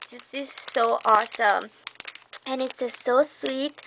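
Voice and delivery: ordinary delivery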